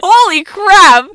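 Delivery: loud, slow, high exclamation; shocked shout. Real, not acted. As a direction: blurt out in shock. Shocked reaction to story.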